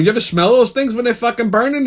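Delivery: ordinary talk, nothing marked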